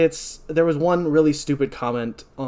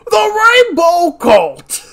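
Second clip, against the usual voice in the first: very goofy high, booming ranter; Alex Jones impression; 14 dB louder, much slower than usual. Host mocks a stupid comment, drawn-out goofy shout. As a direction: bellow it slowly like a goofy conspiracy shouter. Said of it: "doing my best, like, Alex Jones impression".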